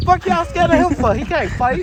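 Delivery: high, loud jeering call; loud taunt. Acted. Striker shouting at teammates who fear the rival, jeering. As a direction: shout down your scared teammates.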